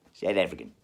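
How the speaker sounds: low flat South African "South African". Acted. Arguing with a mate over which accent, a low South African reply. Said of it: "I'll go back to South African".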